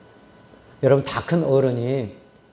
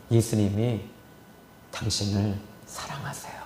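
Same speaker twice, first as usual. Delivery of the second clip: whisper; 6 semitones lower, 7 dB quieter than usual. Retold or imitated. Pastor whispering to patient again.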